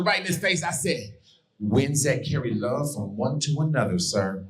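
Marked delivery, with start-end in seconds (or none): 1.6-4.4 nice and fluffy. Retold or imitated. Recruit answering his drill instructor, nice and fluffy. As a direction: let the meaning float out gently like a confession. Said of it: "It came out all nice and fluffy".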